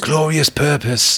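high, drawn-out declaration; grand quotation. Acted. Quoting the Loki show, mock-grand declaration. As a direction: stretch it out like a grand catchphrase.

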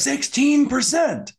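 high, slow panicked yell. Retold or imitated. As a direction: shout it in sudden panic. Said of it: "Then he yells".

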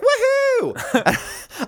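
very high, loud cheer; mock woohoo. Shopper cheering that potatoes have no limit, very high. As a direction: whoop with joy. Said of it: "She's like".

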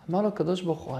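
ordinary delivery

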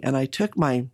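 usual speaking voice

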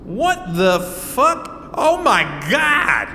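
slow, rising shout of disbelief; horrified shout on seeing the centipede; shocked outburst. Acted. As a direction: recoil at the sight and yell. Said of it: "he goes".

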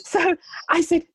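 giggling through the words, high; a real laugh breaking into the speech. Real, not acted. Laughing after the client's outburst.

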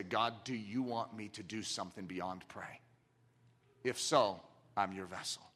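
low, soft, earnest asking; hushed prayer. Retold or imitated. Jackie Pullinger praying over news of the dying, hushed and low. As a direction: pray it quietly, low.

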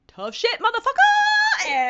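high, loud, slow, drawn out with a laugh; laughing mock retort. Auction house telling Bill off, laughing and high. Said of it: "And they're like".

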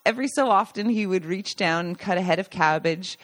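ordinary delivery, nothing marked

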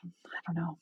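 unsure whispered trailing off; whispered aside. Real, not acted. Host trails off unsure to co-host, whispering. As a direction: drop to a breathy whisper, unsure.

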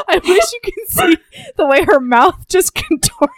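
high voice breaking up with laughter; helpless laughter. Real, not acted. Telling listeners about her co-host's accent face, laughing helplessly. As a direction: squeak it out while cracking up.

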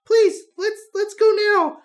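put-on high, loud pleading girl's voice; scared little girl. Stacy begging her friend to leave, high pleading girl voice. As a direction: beg to go like a frightened child.

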